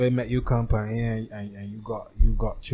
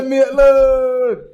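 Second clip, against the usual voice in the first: high, loud, long drawn-out yell; mock crowd cheer; 12 semitones higher, 10 dB louder, much slower than usual. Imitating the crowd's ironic applause at football, drawn-out mock yell. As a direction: drag out a big ironic cheer.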